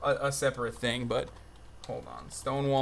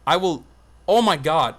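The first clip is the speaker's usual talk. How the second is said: ordinary talk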